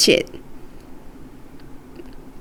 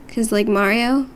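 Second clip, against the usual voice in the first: usual speaking voice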